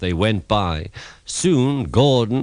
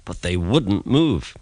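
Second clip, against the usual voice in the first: plain reading voice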